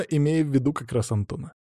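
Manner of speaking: ordinary delivery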